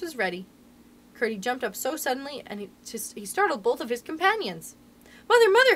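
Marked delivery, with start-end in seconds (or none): 5.3-5.8 high, loud call for mother; urgent cry. A narrator's reading. Boy suddenly remembering, crying out to his mother. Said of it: "he cried".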